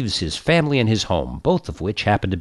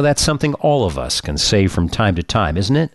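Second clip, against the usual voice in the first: ordinary talk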